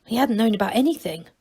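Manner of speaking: usual speaking voice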